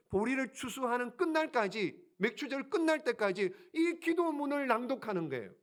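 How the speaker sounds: ordinary talk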